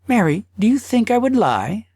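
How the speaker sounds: protest, a little wounded; husband voice. Acted. Husband answering wife.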